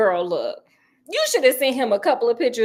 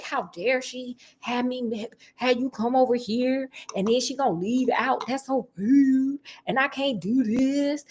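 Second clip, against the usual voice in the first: mock Sonny; 4 dB quieter than usual. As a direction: drag it out like a sulky man. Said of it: "He like".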